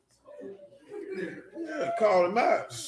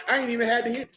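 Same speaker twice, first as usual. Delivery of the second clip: usual speaking voice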